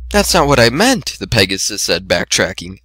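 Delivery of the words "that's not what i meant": higher gentle shy voice; timid Fluttershy. A narrator's reading. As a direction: explain shyly, pitched up.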